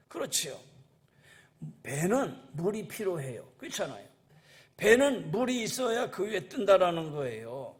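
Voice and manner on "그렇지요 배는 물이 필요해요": ordinary delivery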